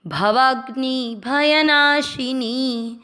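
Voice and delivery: drawn-out chanted syllables; measured Sanskrit chanting; slow recitation. Shown as a demonstration. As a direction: recite it slowly, long vowels held. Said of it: "दीर्घ करना है".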